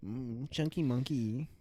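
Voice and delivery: slow, low silly voice on "chunky monkey". Pretend. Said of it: "I did a very particular voice for that too".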